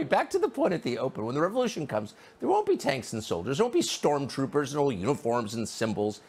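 usual speaking voice

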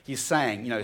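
ordinary talk, nothing marked

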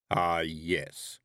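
slow, heavy voice of Death; heavy thundering voice. A narrator's reading. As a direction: let it rumble like distant thunder. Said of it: "said Death".

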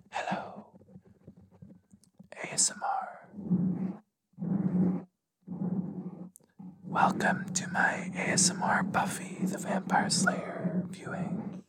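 whispered ASMR welcome; mock ASMR whisper. Host welcoming viewers to an ASMR Buffy viewing, playful whisper.

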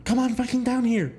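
high-pitched imitation of a feeble yell; mild mock scream. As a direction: scream a little, high and unthreatening. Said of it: "he screams a little bit".

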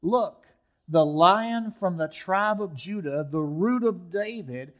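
preacher reading Revelation five to the church; scripture reading. Acted. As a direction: read the vision with weight and build.

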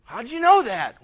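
loud, high, amazed question; mock astonishment. Preacher as mother asking how you knew, mock astonished. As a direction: act out a startled demand to know.

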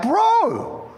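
high-pitched, scandalised exclamation; mock shocked. Preacher retells reacting to friend's cussing, mock shocked. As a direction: act out shocked disbelief with a pitch leap.

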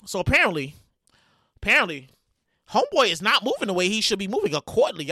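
usual speaking voice

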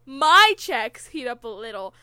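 MY in all capitals, very loud; stress on My. Acted. Reader honours the capital letters, "My" blasted out. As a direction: boom out "My", then read on. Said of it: "my is in all capital letters".

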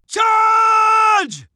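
high, loud battle cry. Acted. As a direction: roar the charge to the troops. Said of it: "Owen's battle cry".

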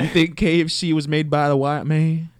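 high, loud comic character voice; voiced Colonel Sanders.

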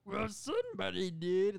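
loud, slow, bad New York accent; Tom Hardy. Boss answering the employee's denial, bad New York accent. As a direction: retort in a high put-on New York accent. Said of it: "The bad New York accent".

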